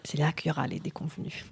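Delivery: low, soft, quick joking aside; wry aside. Real, not acted. Cohost teasing about the mishaps to come, low and quick. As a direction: slip it in under your breath as a joke.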